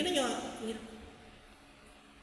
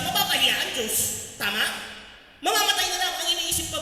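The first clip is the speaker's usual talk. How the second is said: loud, high, driving sermon voice; fiery preaching; 8 semitones higher, 4 dB louder than usual. Acted. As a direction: preach it out with full force to the crowd. Preaching at full force. (masigasig na pangangaral)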